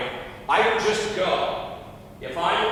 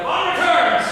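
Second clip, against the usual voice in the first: high shouted proclamation; loud announcement; 11 semitones higher, 7 dB louder than usual. Acted. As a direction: belt out the line. Quoting the laugh line.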